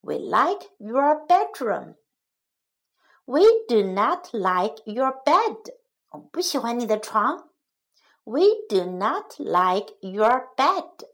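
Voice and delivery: normal talking voice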